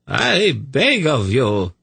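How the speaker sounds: slow, high-pitched plea; imploring character voice; pleading. A narrator's reading. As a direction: beg earnestly, drawing it out. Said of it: "Tzadi pleaded".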